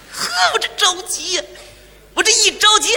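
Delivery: 着急带哭腔. Acted.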